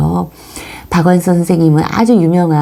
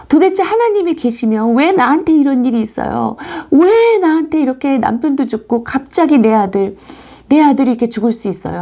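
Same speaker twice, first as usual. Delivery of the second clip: grieving protest; 7 semitones higher than usual. Retold or imitated. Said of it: "그 비통한 마음으로".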